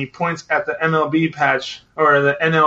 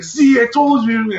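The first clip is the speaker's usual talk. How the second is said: gloating; 7 semitones higher, 4 dB louder than usual. Retold or imitated. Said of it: "be like".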